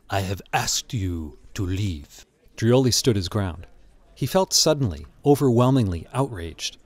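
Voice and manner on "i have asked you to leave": slow, low, quiet insistence; gallery owner. A narrator's reading. Gallery owner insisting the intruder leave, slow and low. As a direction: lower and slow the voice, controlled order.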